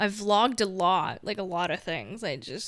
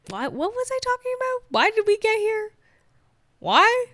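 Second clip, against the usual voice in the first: high, squeaky mock exasperation, getting louder; exasperated squeal; 13 semitones higher, 8 dB louder than usual. Real, not acted. Vlogger losing her own thread, high comic squeal. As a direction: shriek the questions at yourself in comic despair.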